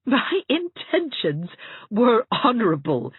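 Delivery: high, slow amused protest; laughing. Acted. Anne amused at Hazel's accusation, laughing. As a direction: laugh at yourself as you say it. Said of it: "said Anne, laughing".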